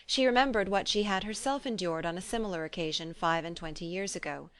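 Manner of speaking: ordinary delivery, nothing marked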